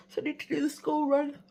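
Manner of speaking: words stretched by a yawn. Real, not acted. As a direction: talk through a yawn. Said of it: "I'm yawning a lot".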